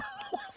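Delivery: very high, soft wheeze of laughter; wheezing laugh. Real, not acted. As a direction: trail into a helpless, squeaky laugh. Cracking up at son's joke.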